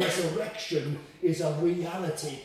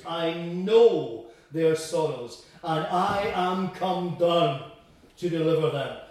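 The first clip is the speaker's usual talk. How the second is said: slow and louder, each word weighted; slow weighty emphasis; 4 dB louder, slower than usual. Shown as a demonstration. Preacher driving home God's promise to deliver, slow and weighty. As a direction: let every word land like a promise. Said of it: "four things there you recognize".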